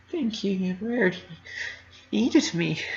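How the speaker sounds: tearful broken thanks; voice breaking. Acted. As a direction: let the voice crack as you thank her. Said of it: "my voice breaking".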